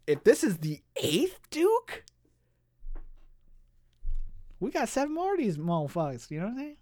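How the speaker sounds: high, hyped-up fanboy rant; real excitement. Real, not acted. Host listing the villain's powers to co-host, wildly excited. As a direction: get carried away, pitch leaping up. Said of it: "Sorry, I'm getting excited".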